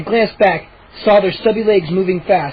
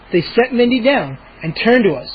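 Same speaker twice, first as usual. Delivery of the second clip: plain reading voice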